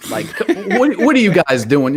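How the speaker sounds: amused, disbelieving question; laughing disbelief. Real, not acted. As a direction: laugh at the absurdity. Mocking fight scene with laugh.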